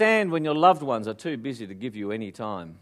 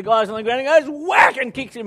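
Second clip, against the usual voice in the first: loud storytelling; 8 semitones higher, 11 dB louder than usual. Retold or imitated. Third kick, loud build.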